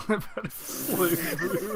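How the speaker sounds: giggling through the words; laughing repeat. Real, not acted. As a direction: laugh while echoing the name. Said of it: "That sounds like a pretty B S bureau".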